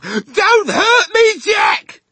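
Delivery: very high, loud, drawn-out scream; panicked pleading scream; terrified yell. A narrator's reading. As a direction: shriek it out, begging not to be harmed. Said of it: "he yelled".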